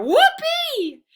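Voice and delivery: very high, loud cheer; joyful whoop. A narrator's reading. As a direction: burst out with delight. Dog thrilled about car ride.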